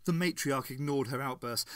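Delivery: usual speaking voice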